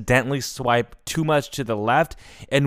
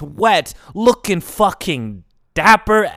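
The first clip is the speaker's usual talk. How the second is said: normal talking voice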